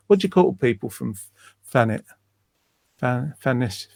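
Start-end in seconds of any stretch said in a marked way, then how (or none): 1.7-2.0 Thanet dengan f, seperti Fanet; Thanet, th said as f, London. Real, not acted. Pronounce it with your London accent with an F.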